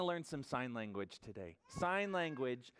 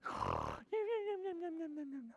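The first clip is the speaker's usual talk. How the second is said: gasp; 14 semitones higher than usual. Acted. Surprise at ending.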